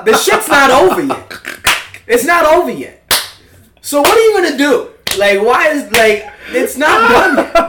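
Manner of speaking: high, loud exasperated protest; frustrated, pleading outburst. Real, not acted. Exasperated protest about unfinished story.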